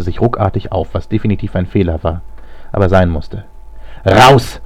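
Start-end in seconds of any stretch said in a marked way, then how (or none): 4.0-4.6 loud, commanding shout; sharp angry yell; calling out. A narrator's reading. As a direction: order them out at full voice. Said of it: "rief er".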